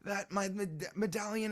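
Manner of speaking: put-on high, babbling child; little boy voice. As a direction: ramble like an excited kid. Child explains himself.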